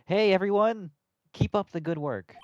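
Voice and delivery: shouting a cheery greeting; loud call-out. Acted. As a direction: call out loudly to the crowd. Greeting cops loudly in game.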